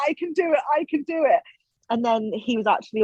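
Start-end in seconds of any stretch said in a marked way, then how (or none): 0.1-1.4 high-pitched imitation of tearful pleading; crying. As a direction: act out crying while insisting, voice high. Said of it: "crying, just going".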